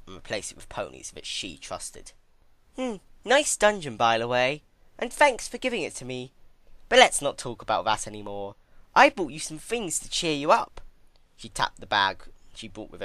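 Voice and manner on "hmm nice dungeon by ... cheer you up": much higher, louder, sing-song cruel sweetness; mock-sweet villainess. Twilight taunting Celestia about her dungeon, sickly sweet and much higher. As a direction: tease her with fake cheer.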